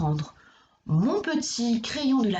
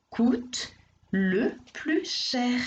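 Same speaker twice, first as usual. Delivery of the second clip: very slow model answer; 2 semitones higher, much slower than usual. Shown as a demonstration. Teacher modelling the answer for a pupil, very slow. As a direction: say it slowly, word by word. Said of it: "Tu peux me dire par exemple".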